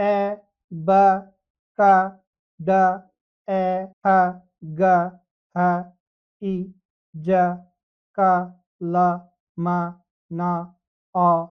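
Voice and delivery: letter sounds called out loud with pauses between; slow paced drill, one sound at a time. Shown as a demonstration. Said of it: "भविष्य में उनका प्रयोग कैसे करना है". (रुक-रुक कर ज़ोर से ध्वनि अभ्यास)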